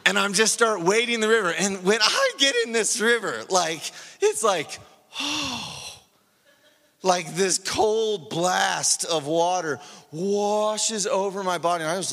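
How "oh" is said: higher, soft acted gasp; gasp of relief. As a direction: gasp as if cold water hits you. Imitates shock of cold river.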